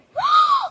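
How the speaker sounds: sharp, very high gasp; delighted-shock gasp. Pretend. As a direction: gasp in pretend amazement. Sees she is all better.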